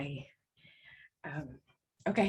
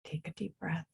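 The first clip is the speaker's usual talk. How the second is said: soft reassuring; 10 semitones lower, 7 dB quieter than usual. Acted. Calming someone before a tense moment.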